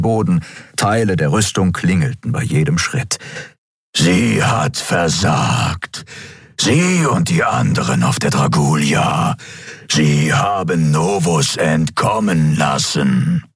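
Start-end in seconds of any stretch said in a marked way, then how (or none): 4.0-13.4 slow, distorted emperor's voice. A narrator's reading. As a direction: condemn slowly through a distorted voice. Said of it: "Seine verzerrte Stimme ließ die Knochen seines Atlatus vibrieren". (langsame, verzerrte Herrscherstimme)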